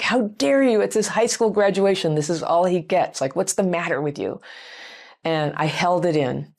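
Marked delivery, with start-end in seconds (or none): 0.0-4.4 higher-pitched indignant scolding, easing down; imagined indignant outburst. Retold or imitated. Mother defending son on graduation day, imagined indignant rebuke. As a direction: act out the telling-off you held back. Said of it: "wanted to say something like".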